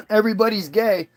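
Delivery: usual speaking voice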